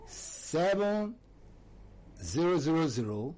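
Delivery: slow, spaced-out number groups with long pauses; slow dictation. Real, not acted. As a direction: read it out slowly for writing down. Dictating phone number to listeners.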